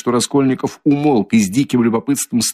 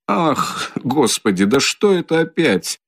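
frightened cry; 6 semitones higher, slower than usual. A narrator's reading. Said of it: "вскрикнул, по-видимому, в совершенном испуге".

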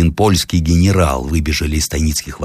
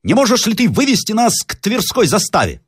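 high, urgent request; high-voiced pressing general; general asking the way. Story figure: general addresses the workman.

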